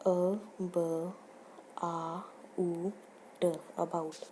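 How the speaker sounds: about split into अ, ब, आ, उ, ट then joined; sound by sound. Shown as a demonstration. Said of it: "for example about".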